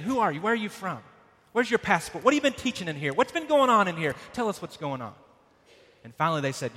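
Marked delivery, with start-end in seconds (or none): 0.0-5.2 demanding questions pitched higher and louder; angry interrogation, higher. Retold or imitated. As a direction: fire the questions harder, like a hostile mob. Saying the same thing.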